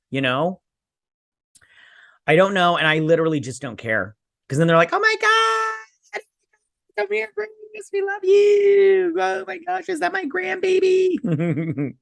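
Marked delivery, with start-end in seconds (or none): 4.9-11.9 very high, slow doting grandma squeal; fawning family. Retold or imitated. Family fawning over Hulk's son, in a squealing, very high doting coo.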